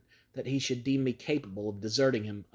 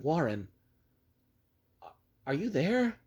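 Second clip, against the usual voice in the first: calling down, desperate; 5 semitones higher than usual. Acted. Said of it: "again I called down".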